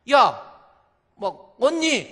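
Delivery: high, loud confronting call; scary sisters-in-law. Retold or imitated. Sisters-in-law confronting their brother's wife, loud angry call. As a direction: call her out sharply as the in-laws. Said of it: "신우들이 무섭잖아요".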